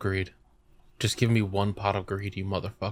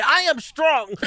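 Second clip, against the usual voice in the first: very high, loud mimicking yell; hammed-up instant retort, way up high; defiant comeback; 6 dB louder, slower than usual. Noah's immediate answer to the weak-boy taunt, defiant high shout. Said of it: "it was so immediate".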